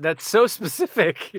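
ordinary talk, nothing marked

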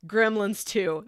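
ordinary talk, nothing marked

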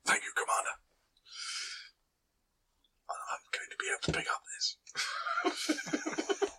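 soft, breathy in-character whisper. Acted. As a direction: whisper the reply to the commander. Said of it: "Just pretend a, a little bit louder".

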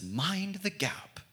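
sweet British announcer. Acted. Preacher mimicking the London Tube intercom, sweet British accent. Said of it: "this sweet British voice that comes over".

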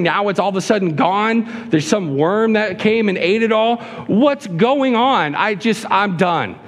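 high-pitched, exasperated mock complaint; Jonah whining. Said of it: "He's whining about it".

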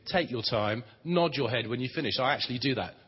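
ordinary talk, nothing marked

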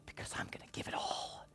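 breathy whisper, near silent. Real, not acted. Preacher whispering his vow of total giving. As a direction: drop to a bare whisper. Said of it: "whispered climax".

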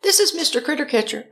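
high-pitched singsong answering-machine voice; recorded greeting. Retold or imitated. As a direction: mimic a chirpy voicemail greeting. Mimics repeated answering-machine greeting.

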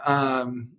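ordinary delivery

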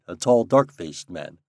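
plain reading voice

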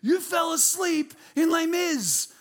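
slow, high, loud disbelief; the wife scolding, drawn out and loud; wife scolding him after the show, in loud disbelief; exasperated wife. Retold or imitated. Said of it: "She goes".

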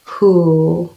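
usual speaking voice

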